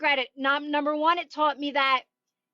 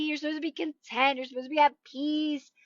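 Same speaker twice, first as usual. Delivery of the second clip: ordinary delivery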